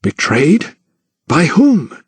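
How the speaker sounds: baffled questions, rising in pitch; incredulous. A narrator's reading. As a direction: question it as if you can't believe it. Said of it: "Thomas eyed him incredulously".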